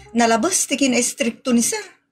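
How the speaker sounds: ordinary talk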